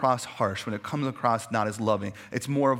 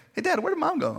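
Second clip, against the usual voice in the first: put-on high, bright kid voice; kid asking dad; faster than usual. Child to dad, asking where mom went, high and bright. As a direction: sing out the question like a child. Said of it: "still to this day".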